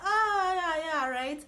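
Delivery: mock sarcasm. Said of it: "we just like".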